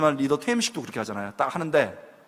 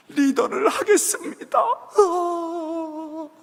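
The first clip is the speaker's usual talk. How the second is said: much higher, slow, laughing half-cry; mock trembling surrender; 11 semitones higher, much slower than usual. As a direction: quaver out the acceptance. Trembling student accepting role.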